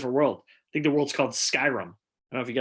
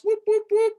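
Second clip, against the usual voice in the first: high, loud whoops; excited whooping; cheering whoop; 6 dB louder than usual. Real, not acted. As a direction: cheer the comic off like a crowd. Host cheering on the comedian.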